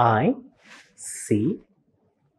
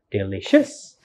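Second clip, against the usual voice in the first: "delicious" with the last syllable raised; wrong final stress; 4 semitones lower, 4 dB quieter than usual. Shown as a demonstration. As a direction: misplace the stress: deli-CIOUS in "delicious". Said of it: "Not the first, like".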